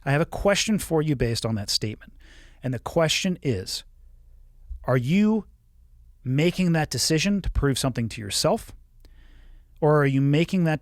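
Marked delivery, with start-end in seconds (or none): none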